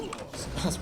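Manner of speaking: quick breathy laugh; short laugh at himself; brief embarrassed laugh. Real, not acted. Laughing at his own hiccup.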